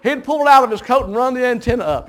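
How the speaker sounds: ordinary delivery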